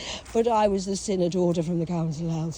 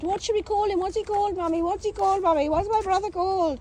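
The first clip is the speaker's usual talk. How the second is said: put-on childish pestering; 10 semitones higher than usual. Child self pestering mum.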